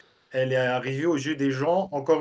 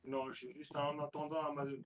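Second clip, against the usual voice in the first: distant voice over call; faint, far from microphone; far from mic; 16 dB quieter than usual. Real, not acted. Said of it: "on t'entend de loin".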